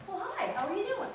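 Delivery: quick casual gloss. Shown as a demonstration. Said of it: "That's a way of saying".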